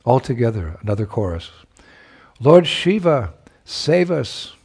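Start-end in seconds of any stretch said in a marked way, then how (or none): 2.4-4.5 crying out. A narrator's reading. Gopis cry to Lord Shiva over the howling wind. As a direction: call out together over the wind. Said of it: "they cried out over this, um, howling wind".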